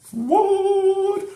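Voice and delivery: high, loud, drawn-out "what?"; shocked exclamation. Retold or imitated. Vlogger reliving a shocking anime twist, high drawn-out shock. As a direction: shriek it in stunned disbelief.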